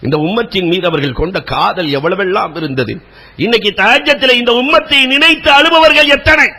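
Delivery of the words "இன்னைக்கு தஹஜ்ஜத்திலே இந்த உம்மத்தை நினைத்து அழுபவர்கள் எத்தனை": high, loud pleading sermon cry; fervent preaching. Acted. Preacher urging the congregation to pray and weep, impassioned high preaching cry. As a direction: urge the crowd to weep in prayer.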